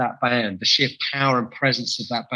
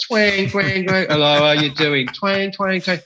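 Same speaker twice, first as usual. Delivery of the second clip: mock twanging guitar strings, a bit higher; imitated guitar tuning; 5 semitones higher, slower than usual. Describing bands tuning up on stage, a light mock twang. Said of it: "they'd start tuning up".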